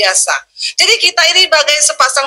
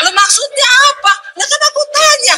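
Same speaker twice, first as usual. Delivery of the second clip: much higher, mock-aggrieved voice; indignant protest; 10 semitones higher than usual. Scolded wife argues back at husband, high indignant protest. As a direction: shoot the pitch up in baffled protest.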